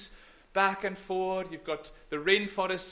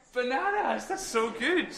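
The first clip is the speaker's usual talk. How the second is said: bright, higher repeat of the word; pleased exclaim; 6 semitones higher, slower than usual. Shown as a demonstration. Praising the children's hearing: pleased exclaim. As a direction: reveal the answer with delight. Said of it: "Oh, dear".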